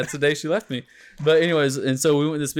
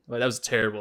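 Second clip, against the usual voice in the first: normal talking voice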